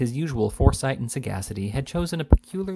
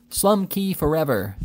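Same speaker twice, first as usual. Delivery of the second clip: roar; 5 semitones higher, slower than usual. A narrator's reading. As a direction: roar it like a whole mob. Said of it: "roared the honest and independent".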